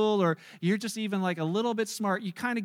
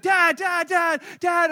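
put-on higher, louder excited child voice; eager kid calling; 6 semitones higher, 6 dB louder, slower than usual. Son begging his dad to come see, excited high calling. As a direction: pester like a kid who can't wait. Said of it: "It's like".

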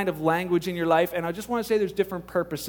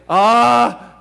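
startled ah; 3 semitones higher, 11 dB louder than usual. Retold or imitated. Startled prayer at odd word.

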